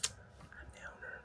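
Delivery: barely audible whisper; whispered aside. Acted. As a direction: whisper it as a secret to the viewers. Secret aside during retold encounter.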